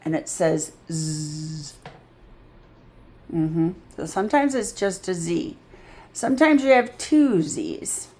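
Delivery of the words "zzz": "zzz" held as a long buzz; Z sound. Shown as a demonstration. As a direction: draw out a buzzing "zzz". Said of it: "sometimes you just put a Z".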